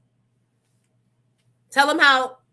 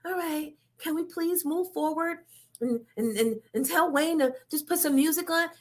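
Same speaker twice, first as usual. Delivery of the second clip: imitating a whiny manager; 5 semitones higher than usual. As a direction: beg like a fed-up handler in a mock whine. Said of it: "somebody texting me with".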